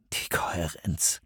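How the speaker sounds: tonlos geflüstert, langsam und schwer; düster geflüstert. A narrator's reading. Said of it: "sagte er düster". (düsteres, langsames Flüstern)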